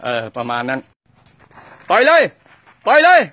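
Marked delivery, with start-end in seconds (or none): 1.9-3.2 high, loud calls to act; shouted cue. Real, not acted. Director calls out to actors to throw the punch, loud and high. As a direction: yell the cue across the set.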